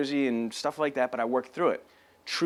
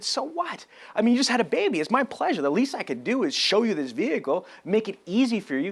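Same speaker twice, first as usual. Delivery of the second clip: high, upbeat reassurance; eager reassurance; 6 semitones higher than usual. Retold or imitated. Salesman eagerly offering to bring the car to her. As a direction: sell the kindness eagerly.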